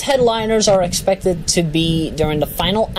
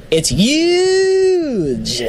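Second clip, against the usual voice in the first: mock Bernie huge; 10 semitones higher than usual. Bernie-style exclamation.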